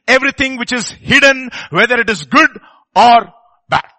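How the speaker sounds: loud, high, urgent sermon delivery; impassioned pulpit exhortation; forceful preaching. Real, not acted. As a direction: preach it out at full voice.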